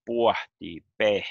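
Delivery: "pohti, behtet" stressed on first and third syllables; stress every second syllable. Shown as a demonstration. Teacher demonstrates four-syllable rhythm, "pohti, behtet" with alternating stress. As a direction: hit "poh" and "beh" in "pohti, behtet". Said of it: "stress on every second".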